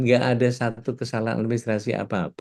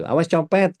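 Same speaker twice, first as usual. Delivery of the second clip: high-pitched, half-raised alert cry; mock warning shout; 7 semitones higher, 4 dB louder than usual. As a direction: call out a mock pickpocket warning to everyone around. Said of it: "Kita teriak gitu". (seruan peringatan pura-pura, nada tinggi)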